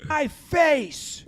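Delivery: high, drawn-out yelling imitation; mock angry yell. Yankees coach to the Orioles coach, high mock-angry yell. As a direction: act out a coach yelling to back off. Acted on-field argument continues.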